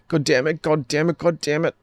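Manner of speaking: put-on fleeing girl swears in despair; desperate cursing. Girl fleeing the shooting curses over and over, desperate.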